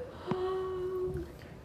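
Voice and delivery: long, drawn-in gasp; soft, high mock gasp; acted gasp. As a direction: gasp in character. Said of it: "and goes like".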